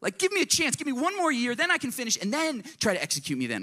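high pleading protest, fading near the end; angry protest. Retold or imitated. Condemned man protests he wasn't there, indignant and high. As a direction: plead your innocence, pitch up high. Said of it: "I'd be angry".

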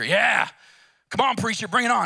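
loud, high whoops of a cheering crowd. Retold or imitated. Congregation cheering the temperance preacher on, loud and high. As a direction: egg him on like a roaring crowd.

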